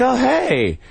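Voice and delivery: high-pitched re-enacted hello; mock greeting. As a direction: echo her greeting high and awkward. Acting out his own reply greeting.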